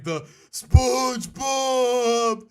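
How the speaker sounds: slow, high, shouted squeal; squealing SpongeBob. Retold or imitated. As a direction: squeal the name like the dub. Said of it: "Just the squealing".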